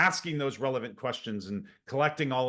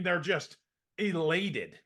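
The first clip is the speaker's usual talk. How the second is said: ordinary delivery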